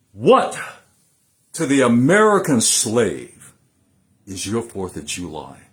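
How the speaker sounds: thundering nineteenth-century oratory; impassioned oration. Acted. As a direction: deliver it like a fiery speech to a hall. Recites Douglass's Fourth of July speech.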